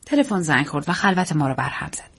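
plain reading voice

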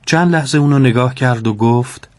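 plain reading voice

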